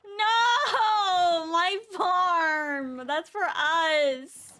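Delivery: loud, upset cry of no; distressed shout. Real, not acted. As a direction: shout to protect the farm. Protecting her farm.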